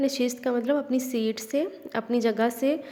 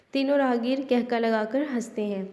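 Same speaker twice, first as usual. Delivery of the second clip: usual speaking voice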